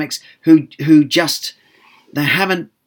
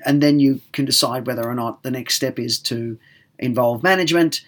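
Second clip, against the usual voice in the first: ordinary talk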